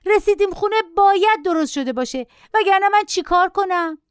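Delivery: put-on high, whiny child's shriek. A narrator's reading. Child shrieks at mother on the bus, high and whiny. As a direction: play a spoilt kid screaming.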